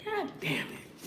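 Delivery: high, soft awed curse; a high, hushed God damn it; breathy high exclamation. Real, not acted. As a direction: let it out, amazed and quiet. Exclaiming at her settling.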